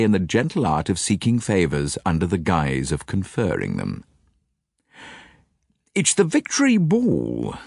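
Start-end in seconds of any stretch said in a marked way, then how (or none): 5.9-7.6 persuasive. A narrator's reading. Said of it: "said Japp persuasively".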